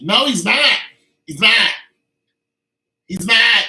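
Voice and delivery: loud, high, hammered denial; loud insistent he's not; emphatic loud no. Real, not acted. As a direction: shut down the idea loudly.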